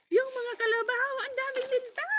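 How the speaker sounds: high, wailing complaint; mock wail. To her brother about the leeches, a high mock wail. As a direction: wail it like a scared child. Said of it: "nag-iyak ako".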